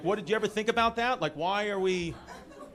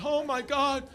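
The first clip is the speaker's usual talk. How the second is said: higher, slow, hammed-up mourning over the koalas; mock somber grief; 7 semitones higher, 4 dB louder, much slower than usual. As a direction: wail it like a melodramatic news feed. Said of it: "they made it so somber".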